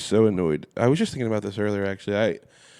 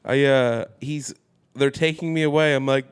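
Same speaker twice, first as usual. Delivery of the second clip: ordinary talk, nothing marked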